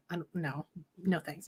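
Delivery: very soft, low imitated reply; mock timid refusal. Awkward children turning down play, mock quiet refusal. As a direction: decline it under your breath.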